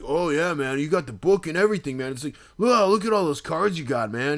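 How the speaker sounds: put-on sarcastic drunk. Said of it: "he's like".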